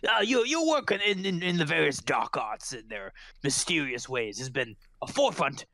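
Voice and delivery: effusive, stumbling official welcome; admiring constable. Acted. Constable welcoming a dark arts expert, formal halting admiration. Game master plays the constable.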